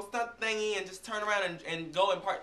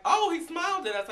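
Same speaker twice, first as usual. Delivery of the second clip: mock saleslady; 11 semitones higher, 5 dB louder than usual. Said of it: "the lady was like".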